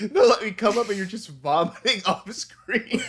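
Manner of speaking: laughing through the words, high and loud; real laughter while speaking. Real, not acted. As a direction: giggle it out as you picture it. Laughing at the idea.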